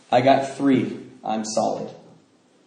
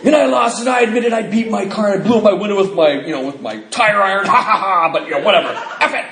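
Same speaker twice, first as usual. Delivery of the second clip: mocking a newcomer; 5 semitones higher, 4 dB louder than usual.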